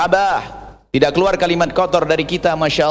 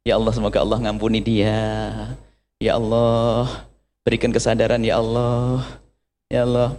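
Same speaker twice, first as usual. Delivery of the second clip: acted patient prayer; 5 semitones lower, 4 dB quieter than usual. Second woman praying for her wrongdoer, soft and forgiving. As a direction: pray gently for the one who wronged you. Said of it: "yang kedua, didolimi".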